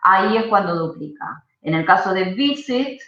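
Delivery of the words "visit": VIsit, stressed on the opening syllable; stress on first syllable. Shown as a demonstration. Teacher explaining no doubling, visit stressed on the first syllable. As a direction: put the weight on VI in visit. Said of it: "la acentuación está en la primera".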